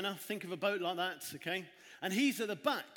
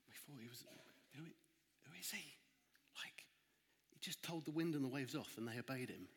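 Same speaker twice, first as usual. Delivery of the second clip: much softer, lower fearful whispering; hushed whisper; 5 semitones lower, 10 dB quieter than usual. Acted. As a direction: huddle and whisper in awe. Disciples huddling, whispering.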